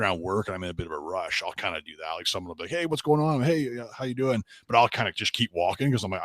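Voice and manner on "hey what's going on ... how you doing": high, louder upbeat hail; hearty greeting. Retold or imitated. Coworker hails someone walking around work, hearty and upbeat. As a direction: imitate someone calling a friendly hello. Acting out coworker hailing him in passing.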